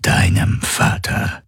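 slow, rasping creature voice; rough, voiceless, drawn-out menace; menacing alien predator. Acted. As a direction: savour each word as a grinning alien hunter.

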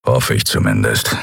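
low, grumbled afterthought; grumbling. A narrator's reading. Keru hoping the others are safe, grumbling. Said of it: "brummte Keru".

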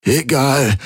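high, strained croak; hoarse, pained urgency. A narrator's reading. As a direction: croak it out with effort. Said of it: "krächzte Garland".

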